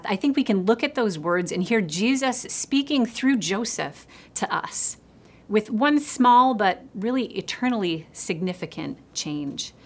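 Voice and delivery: ordinary delivery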